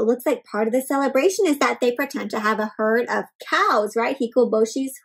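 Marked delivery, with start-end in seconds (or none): none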